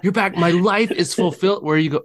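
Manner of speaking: eager puppy gushing, high and bright; excited dog. Pretend. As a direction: gush like a dog thrilled you're back. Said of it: "I feel like they're so".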